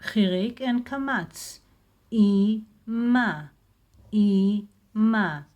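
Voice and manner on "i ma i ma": "I" then "ma", each syllable said loud and apart; split into syllables. Shown as a demonstration. Teacher splitting Ima into syllables for learners, loud and separated. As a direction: sound out "I, ma" syllable by syllable, with a pause between. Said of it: "One syllable, second syllable".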